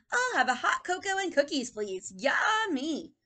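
put-on high, loud child's order; eager little boy. Teacher voices boy ordering cocoa, high and eager. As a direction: relish the treat.

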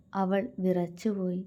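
plain reading voice

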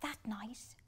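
ordinary delivery, nothing marked

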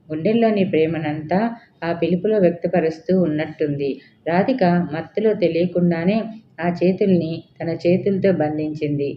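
ordinary delivery

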